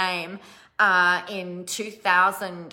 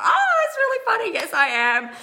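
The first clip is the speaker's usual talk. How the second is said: high, loud flustered giggle through the words; giddy, caught-off-guard reply; embarrassed laugh; 6 dB louder than usual. Retold or imitated. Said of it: "I got so embarrassed".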